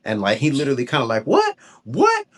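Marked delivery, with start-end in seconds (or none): 1.3-2.3 very high, yelping "what?"; mock indignant dog, pitched way up; offended dog. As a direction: voice an affronted dog in high squeaks. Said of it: "I could tell he got offended by that".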